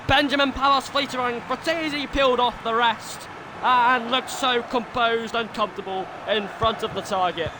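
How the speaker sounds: live football commentator voice; excited stadium commentary; play-by-play commentary. Acted. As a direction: call the match like a TV pundit.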